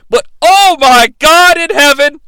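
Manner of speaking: loud, high commentator outburst; shocked exclamation. Acted. Recapping a surprise attack in the ring, loud shock. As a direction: cry out in big, hammed-up shock.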